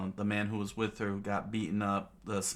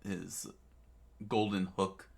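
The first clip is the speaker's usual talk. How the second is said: plain reading voice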